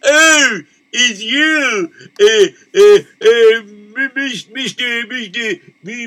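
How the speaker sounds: high, loud, stammering janitor voice; a flustered servant who stumbles over his words; nervous janitor. Acted. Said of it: "call, uh, my janitor".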